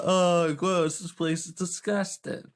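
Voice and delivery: ordinary delivery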